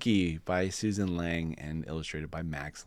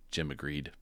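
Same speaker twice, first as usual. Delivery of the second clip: ordinary delivery, nothing marked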